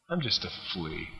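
soft, low understated quote; quiet humility. Retold or imitated. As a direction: say it small and low. Same line repeated, reflectively.

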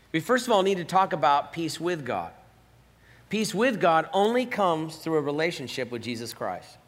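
ordinary delivery

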